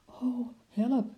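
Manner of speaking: high, shocked gasp of words; startled. Acted. Wim sees the uniform, high startled cry. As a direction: suddenly realize the mistake. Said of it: "zei hij geschrokken".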